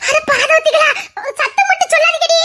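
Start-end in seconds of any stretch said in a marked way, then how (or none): none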